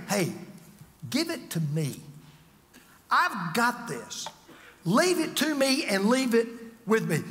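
gentle, slow, understated voice of God; a hint of God's reassurance. Voicing God reassuring the listener.

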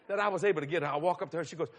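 ordinary talk, nothing marked

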